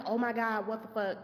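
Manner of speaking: usual speaking voice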